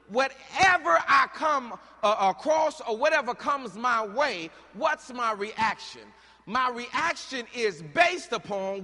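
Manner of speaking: loud, slow, rising sermon cadence; preaching at full force. Acted. Preacher firing up the congregation, loud and slow. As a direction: drive the scripture home to the congregation.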